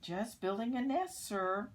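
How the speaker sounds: the zingazoo bird answering the moose; cheeky bird. A narrator's reading. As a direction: chirp it up loud like a busy bird. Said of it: "the zingazoo said".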